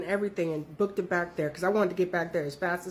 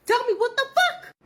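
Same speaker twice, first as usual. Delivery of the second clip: high, louder frantic questions; retold panic; 14 semitones higher, 7 dB louder than usual. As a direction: panic along with her. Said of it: "Her panic is making me panic".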